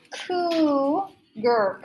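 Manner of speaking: COUGAR drawn out slowly as koo, ger; slow sounded-out COUGAR; syllable by syllable. Shown as a demonstration. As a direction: break COUGAR in two, holding the long oo. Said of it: "a k sound".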